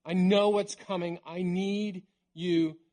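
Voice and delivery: lightly acted calling out to friends; earnest retold appeal, slowing on the last words. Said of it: "He called out to his disciples".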